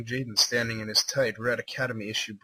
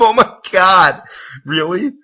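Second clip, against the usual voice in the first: loud, stretched, high cry of disbelief; shocked outburst; 7 semitones higher, 14 dB louder than usual. Real, not acted. Reader shouts in shock at the fanfic's turn. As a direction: yell it in horror.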